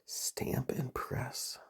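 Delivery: slow whisper to self. Real, not acted. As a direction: breathe the words out slowly. Thinking aloud.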